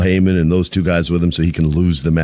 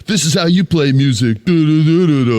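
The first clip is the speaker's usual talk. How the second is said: mock trash talk; 7 semitones higher than usual. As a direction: taunt like a heel mocking the singer. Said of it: "she was talking mad shit".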